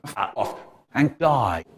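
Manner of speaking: normal talking voice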